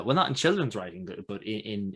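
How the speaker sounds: normal talking voice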